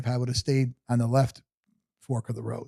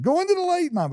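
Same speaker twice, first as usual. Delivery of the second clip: joke-call her onward in a high falsetto; playful eerie call. Pretend. Son teasing his dying mother toward the light, playful eerie call.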